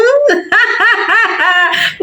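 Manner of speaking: a burst of high laughter; giggle, caught off guard; giddy laugh at the surprise. Real, not acted.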